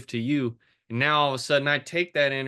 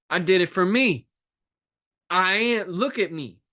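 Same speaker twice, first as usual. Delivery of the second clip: excited realization; 5 semitones higher, 4 dB louder than usual. Retold or imitated. Voicing sudden self-realization at gym.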